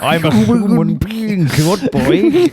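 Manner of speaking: high, loud, slow mock cry; hammed-up anguished plea in a put-on voice; Elephant Man. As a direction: stretch it out high and loud, sending up the role. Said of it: "Is it Elephant Man now?".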